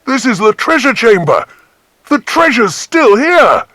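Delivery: put-on high, loud child's voice crying out in discovery; a child shouting with joy at the find; excited child. A narrator's reading. Child exclaiming to the others at the treasure.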